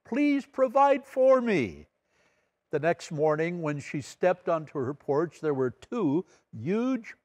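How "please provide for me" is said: high, slow pleading cry to heaven; high, slow praise turned to a plea; pleading praise shout. Retold or imitated. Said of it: "the lady shouted".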